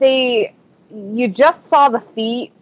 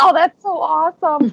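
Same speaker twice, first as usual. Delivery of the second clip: lightly excited, higher voice; retold delight; 6 semitones higher than usual. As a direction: relive a fan's happy reaction. Retelling her delighted reaction.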